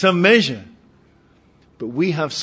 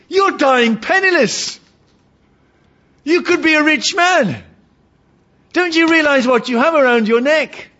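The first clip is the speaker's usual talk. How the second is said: doctor's urgent plea; 12 semitones higher, 4 dB louder than usual. Retold or imitated. Doctor scolds the dying man.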